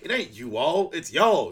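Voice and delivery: loud, high correction landing on "y'all"; mock pronunciation correction. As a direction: contrast "you all" with one-syllable "y'all".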